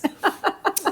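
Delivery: quiet high giggle; soft high laugh. Real, not acted. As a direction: giggle at yourself. Laughing after correction.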